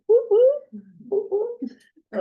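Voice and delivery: high playful woo-hoo cheer. Real, not acted. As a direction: cheer the news like a party. Celebrating new funding.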